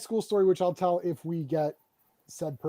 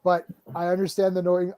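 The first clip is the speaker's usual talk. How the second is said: ordinary delivery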